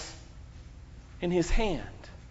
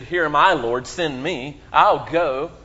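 firm, loud offer with a slow "Lord, send me"; emphatic; 3 semitones lower, 4 dB louder than usual. Retold or imitated. Prophet Isaiah eagerly volunteering to God, emphatic and loud. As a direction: answer God like a volunteer stepping forward. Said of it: "he says it very emphatically".

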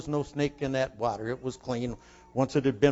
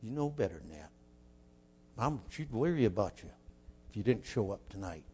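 low, hushed self-reproach; soft, low voice in the head; inner voice of conscience; 5 semitones lower, 9 dB quieter than usual. Retold or imitated. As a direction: murmur it softly, as a worried second thought. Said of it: "And I thought".

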